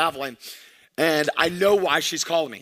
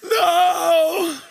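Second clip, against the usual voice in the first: imitating scared child; 10 semitones higher, 3 dB louder than usual. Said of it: "son answering dad's threat".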